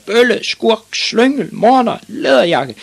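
high, loud screeched insults; outraged old-lady shouting; threatening old lady. A narrator's reading. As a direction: hurl each insult slowly and shrilly. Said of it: "råbte den gamle dame og truede".

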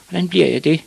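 put-on high-pitched boy voice; young boy's question. A narrator's reading. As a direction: ask as the small son. Boy questions his father.

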